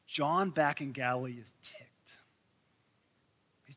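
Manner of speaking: breathy whispered aside, no voice at all; stage whisper. Acted. Preacher whispers the story's turn to the congregation. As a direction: lean in and whisper the secret.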